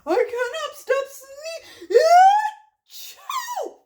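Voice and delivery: high, slow wail breaking into a sneeze; wail, then burst into a mock sneeze; distressed Gerald. Gerald cannot stop sneezing, distressed.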